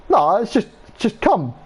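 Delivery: high, sheepish reply; squeaky awkward excuse. Retold or imitated. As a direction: squeak it out innocently. Retold friend's awkward answer.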